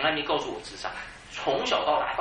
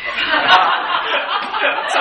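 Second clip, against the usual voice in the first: big rising laugh, high and loud; loud laughter; 13 dB louder than usual. Real, not acted. Pastor laughing loudly at his own story.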